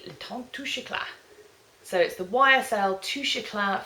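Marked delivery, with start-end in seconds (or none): 0.0-1.1 French accent. Shown as a demonstration. Vlogger reading a French product name, playful French accent. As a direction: show off your school French on the name. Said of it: "I'm loving using my A-level French accent".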